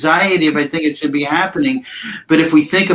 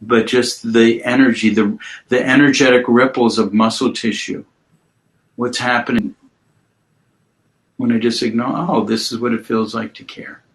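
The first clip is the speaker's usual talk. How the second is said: guided mindfulness; 4 semitones lower than usual. Acted. Guides client through body awareness.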